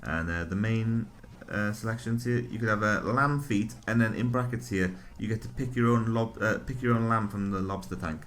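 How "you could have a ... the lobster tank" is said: ordinary talk, nothing marked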